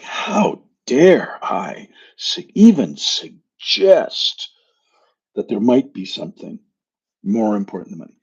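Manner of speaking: hammed-up offense at the suggestion, settling down; imitating offended outrage. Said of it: "He got really kind of pissed".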